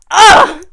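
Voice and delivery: loud, high groan of disgust; exasperated ugh. Real, not acted. Vlogger fed up with men embarrassed of women, loud ugh. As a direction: groan it out loudly.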